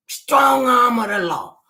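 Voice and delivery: slow, loud, high punch on each word; emphatic. Real, not acted. Describing her strict mother, slow loud emphasis. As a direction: hammer it out slowly.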